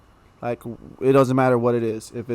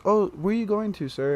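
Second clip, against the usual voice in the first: lightly voiced old lady, pitched higher; a hint of a sweet, higher-pitched old lady; 7 semitones higher, 3 dB quieter than usual. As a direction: imitate a sweet old lady's polite question. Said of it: "a very sweet lady".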